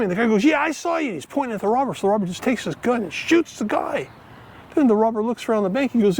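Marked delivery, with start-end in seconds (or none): 0.4-1.1 high-pitched eager joke witness; excited confirmation. Acted. Witness answering the robber in a joke, excited confirmation.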